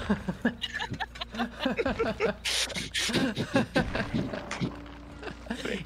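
long, breathless laughing fit; wheezing laugh. Real, not acted. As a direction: laugh helplessly until only wheeze is left. Helpless laughter among friends.

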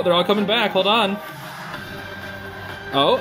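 usual speaking voice

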